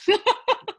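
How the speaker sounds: high bright burst of laughter; rueful laugh. Real, not acted. Actor laughing about losing a part to Fiona Shaw, high laugh. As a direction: laugh it off, amused at her own bad luck.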